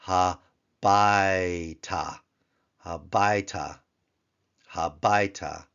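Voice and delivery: habaita, stressed on BAI; teacher modelling habaita; Hebrew habaita. Shown as a demonstration. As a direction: say habaita clearly, syllable by syllable. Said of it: "could become".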